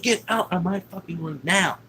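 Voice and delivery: high-pitched retold demand; angry order. Dad ordering stepmother out of room, retold anger. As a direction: imitate an angry man throwing someone out.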